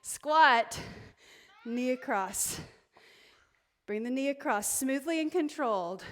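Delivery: ordinary talk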